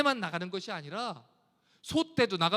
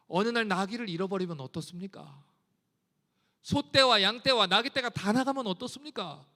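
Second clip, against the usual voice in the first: ordinary talk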